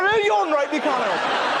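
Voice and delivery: a bloke pouncing with a playground prank; mock delighted shriek; gleeful yelp. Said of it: "you'll see an old".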